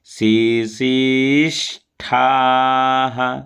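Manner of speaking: शिशिष्ठाः with ष as sa, slow; sa sound. Shown as a demonstration. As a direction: say ष in शिशिष्ठाः like sa, not kha. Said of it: "परंतु यहाँ पर देखिए सा बोला जा रहा है". (शिशिष्ठाः में ष को सा बोलें)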